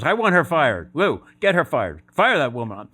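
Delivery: angry demand. Retold or imitated. Owner to Lou, demanding the lady be fired, loud and angry.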